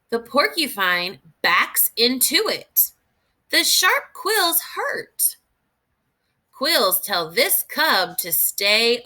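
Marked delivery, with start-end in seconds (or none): 3.5-5.4 high, slow, drawn-out warning; storybook emphasis, slow and high; dramatic read. Acted. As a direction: make the sharp quills sound scary to kids. Reading a book aloud to children.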